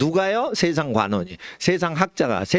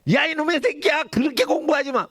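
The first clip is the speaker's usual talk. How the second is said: much higher, imitating a grandfather's angry scolding; mock grandfather scolding; 13 semitones higher than usual. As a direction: scold the grandchild half in play. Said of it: "막 할아버지가 막 화를 내시지".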